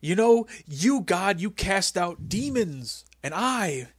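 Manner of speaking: mock sermon to God; imitating Vince. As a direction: preach it slowly like a boss comparing himself to God. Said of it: "He's like".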